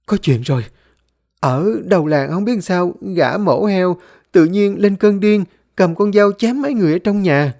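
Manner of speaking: panicked high-pitched report; Liên. Acted. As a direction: blurt out alarming news in fright. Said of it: "hốt hoảng, vừa thở vừa nói".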